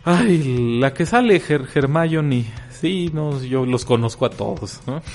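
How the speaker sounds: trying hard to sound seductive. Acted. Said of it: "con tu mejor voz seductora".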